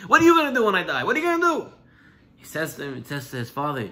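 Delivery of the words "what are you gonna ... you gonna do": high, rising-pressure challenge to a son; demanding question. Acted. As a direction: put him on the spot, pitch climbing. Father challenges second son.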